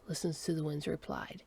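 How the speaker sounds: ordinary delivery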